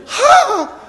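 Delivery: high, sudden mock startle; hammed-up jolt awake; startled cry. Preacher mimicking waking to the alarm, startled cry.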